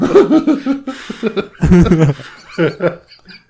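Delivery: loud, high belly laugh; helpless laughter. Real, not acted. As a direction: laugh until you can't stop.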